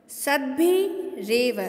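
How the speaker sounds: सद्भिरेव spoken slowly as one joined word; slow joined recitation. Shown as a demonstration. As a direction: draw out सद्भिरेव as a single word, syllable by syllable. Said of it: "इसको यदि मैं संयुक्त करके पढ़ती हूँ". (सद्भिरेव को धीरे-धीरे जोड़कर बोलें)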